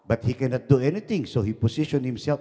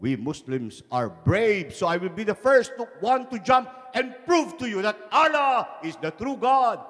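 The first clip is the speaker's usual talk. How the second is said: high, rising proclamation; boastful declaration; 8 semitones higher than usual. Acted. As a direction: declare your bravery to the rivals. Acted boast before the cliff jump.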